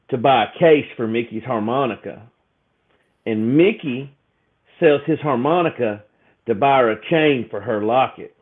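ordinary delivery

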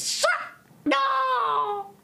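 high, sung-out creepy cat call; eerie cat voice. Acted. Witch cat croons at Jack for his gravy.

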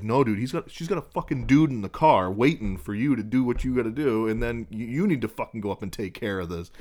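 loud, insistent imitated brother; mock scolding. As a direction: act out telling dad off, easing slightly by the end. Brother insisting dad deal with it.

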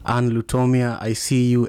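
normal talking voice

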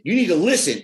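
loud, high, angry scolding; aggressive retold. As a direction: scold him hard, pitch up and push volume. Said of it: "I was very, like, aggressive".

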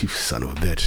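low, soft growled insult; mock gruff retort. Chef answering the bartender, low and gruff. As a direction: drop it low and grumbly.

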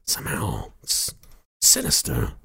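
a slow, creepy celebrity impression with odd pauses; Christopher Walken. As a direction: sound eerily unsettling, pausing oddly mid-phrase. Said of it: "Christopher Walken's always there".

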